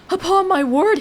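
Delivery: hammed-up Victorian surprise; still surprised. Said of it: "he repeated, still surprised".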